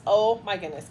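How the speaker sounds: ordinary delivery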